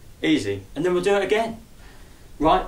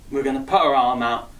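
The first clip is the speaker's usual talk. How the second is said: ordinary talk, nothing marked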